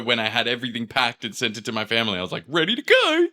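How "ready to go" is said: very high, chirpy quote; cheery announcement. Retold or imitated. Messaging family with bags packed, chirpy excitement.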